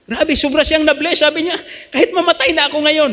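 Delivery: high, laughing retelling; laughing joy. Retold or imitated. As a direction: laugh with delight as you tell it. Joyful retelling of blessing. (masayang tumatawa habang nagkukuwento)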